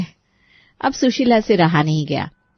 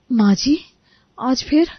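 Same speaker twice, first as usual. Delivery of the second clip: higher, disbelieving bride; weary surprise; 5 semitones higher, slower than usual. Acted. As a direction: sigh out the question. Preeti at door again.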